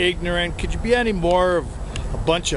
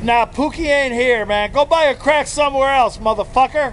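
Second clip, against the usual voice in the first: loud, higher street-tough voice; mock gangster; 6 semitones higher, 4 dB louder than usual. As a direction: brush off the caller like a dealer. Fake phone call as gangster.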